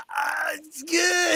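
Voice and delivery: high, slow strained cry; strained, hanging on. Retold or imitated. Comic hanging from the balcony, high and strained. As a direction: force it out while dangling.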